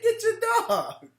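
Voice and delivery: high, slow, laughing mimic of the man; mock quote. Retelling the birdwatcher's words, laughing high mimic. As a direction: squeak it out while cracking up. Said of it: "He said".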